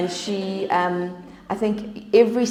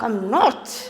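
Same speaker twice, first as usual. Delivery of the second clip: indignant, punchy denial played for laughs; mock aggressive; 3 semitones higher, 4 dB louder than usual. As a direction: protest too much, proving the accusation. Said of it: "you're so aggressive".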